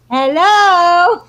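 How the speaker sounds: high, loud, drawn-out hello; playful greeting. Retold or imitated. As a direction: sing out a cheery peekaboo hello. Voices sun peeking through window.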